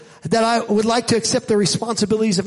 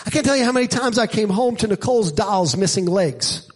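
ordinary talk, nothing marked

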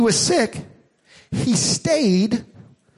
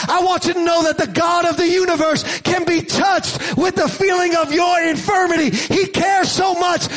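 raised, urgent sermon build; fiery preaching; 7 semitones higher than usual. Acted. Preacher urging the hurting congregation, full-force preaching. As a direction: drive it home to the crowd.

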